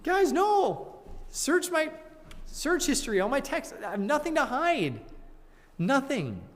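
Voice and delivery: very high-pitched, hammed-up protest; Job protesting innocence. Job answering his accusing friends, high mock protest. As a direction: squeal it like a falsely accused man. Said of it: "How does Job respond?".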